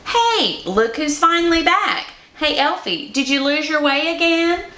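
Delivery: high teasing elf voice; mock the returning elf from across the yard; taunting elf call. Tiny elf calling out to his friends, high and teasing. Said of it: "he called out to the others".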